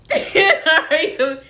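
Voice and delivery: high burst of laughter; amused laugh. Real, not acted. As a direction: burst out laughing mid-story. Laughs at mother's question retold.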